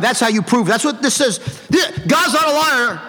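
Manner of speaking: normal talking voice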